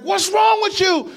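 shouting at the careless driver, high and loud; angry shout. Retold or imitated. Stranger yelling at the driver who nearly hit him, angry shout. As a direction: yell it, furious and high-pitched. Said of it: "he's like".

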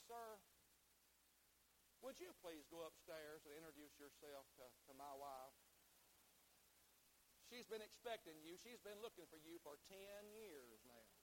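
low, soft, unhurried politeness; underplay it as a composed, courteous husband; very calm. Retold or imitated. Husband addresses the burglar downstairs, very calm. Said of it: "that husband very calmly said".

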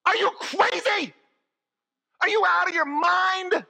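high, loud disbelieving protest; can't believe they brought him back; a hammed-up, outraged Lazarus; incredulous outrage. Lazarus indignant at being revived.

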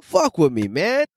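normal talking voice

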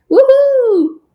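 high loud happy whoop; cheerful whoop. Real, not acted. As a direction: let out a joyful cheer. Cheering for Monday.

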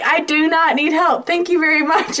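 high, clipped mock dignity; flustered brush-off. Mom waving off the cops, high and flustered. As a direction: shoo them off in a flustered rush. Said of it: "And I'm like".

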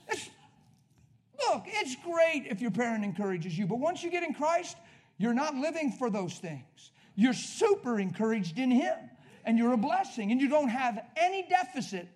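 loud, high-pitched sermon delivery; fervent preaching. Real, not acted. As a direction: drive each point home at full preaching volume. Preaches at full force.

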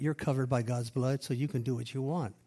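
normal talking voice

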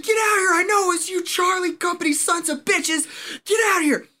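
high, loud angry yelling; furious shout. Retold or imitated. Owner pounding on barracks doors at the marines, furious yelling. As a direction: bark them out of their rooms.

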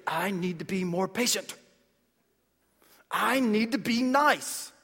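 effortful, put-on self-pep-talk; mock straining resolve. Person trying to muster up patience alone, mock straining. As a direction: force it out as if mustering virtue. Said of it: "just need to stand there and say".

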